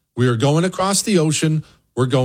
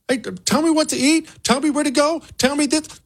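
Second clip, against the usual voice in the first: pleading to be told; 13 semitones higher than usual. Retold or imitated. Mocking freed slaves wanting orders.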